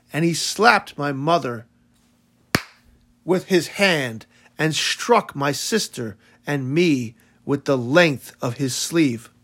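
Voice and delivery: ordinary delivery, nothing marked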